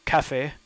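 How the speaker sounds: café said the French way, stress on the last syllable; café with a light French ending. Shown as a demonstration. French word shown to Chibi, café said the French way. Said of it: "I also like the French word for coffee".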